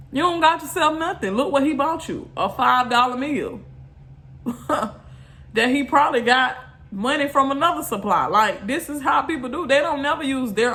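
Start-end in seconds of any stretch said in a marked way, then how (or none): none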